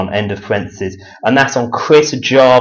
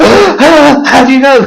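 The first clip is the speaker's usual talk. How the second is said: gasping, high and loud mock panic; alarmed reaction; 10 dB louder, slower than usual. Man rattled by talk of the Freemasons, mock alarm. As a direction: imitate a man spooked at being found out. Retelling a startled, alarmed reply.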